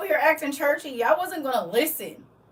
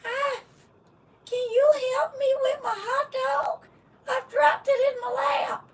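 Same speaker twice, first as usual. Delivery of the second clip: put-on much higher, frail old man whine; 11 semitones higher, 4 dB quieter than usual. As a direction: whimper like a helpless old man. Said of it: "what's the old man on, um, Family Guy?".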